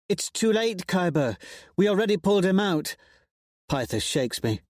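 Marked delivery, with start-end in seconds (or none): 0.1-2.9 much higher, quick defiance; defiant friend. A narrator's reading. Friend to Kyber, defiant. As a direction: brush the guard off.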